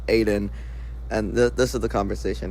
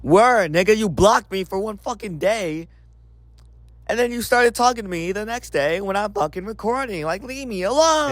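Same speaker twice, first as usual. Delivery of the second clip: high-pitched indignant complaint; exasperated retort; 8 semitones higher than usual. Real, not acted. Complaining about being blocked and then pestered while recording, exasperated. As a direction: protest in rising exasperation, climbing higher to the end. Snaps back at quoted message.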